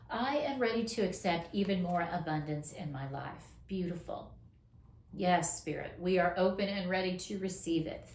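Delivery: ordinary talk, nothing marked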